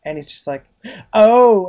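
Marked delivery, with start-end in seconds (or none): 1.1-1.7 louder, higher drawn-out "oh"; acted realisation. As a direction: suddenly catch on, playing it up. Acted reaction, sudden realisation.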